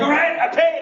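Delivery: high-pitched, pleased imitation; mock triumph. Brother-in-law thinks he has paid, mock triumph. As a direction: announce it as if the job is done. Retold premature triumph at checkout.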